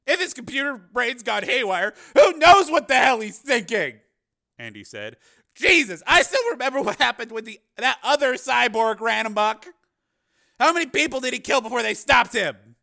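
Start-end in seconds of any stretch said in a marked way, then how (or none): 0.1-4.0 loud, high agitated retort; alarmed argument. A narrator's reading. Cameraman counters reporter's trust in cyborg, alarmed. 5.5-12.8 loud, high-pitched alarm; agitated. A narrator's reading. Cameraman reminds reporter of earlier cyborg rampage, agitated alarm.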